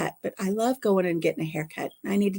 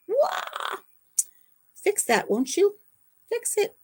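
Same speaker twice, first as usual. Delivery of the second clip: high playful whine; mock pleading falsetto; silly squeal; 12 semitones higher, slower than usual. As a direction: squeak like a pouting princess.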